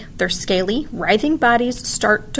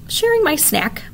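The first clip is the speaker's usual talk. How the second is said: put-on little girl voice; 8 semitones higher than usual. A narrator's reading. Little sister answers offhand.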